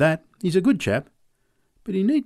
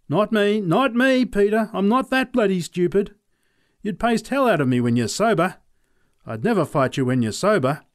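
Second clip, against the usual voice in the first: raised, pleading refusal; high protesting voice; 8 semitones higher, 4 dB louder than usual. A narrator's reading. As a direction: back off loudly, half laughing. Charlie refuses fight, protesting loudly.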